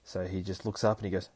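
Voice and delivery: ordinary talk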